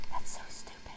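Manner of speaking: whispered, annoyed aside. Real, not acted. Girl grumbling that his parents won't drive him, whispered. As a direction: hiss it under your breath, fed up.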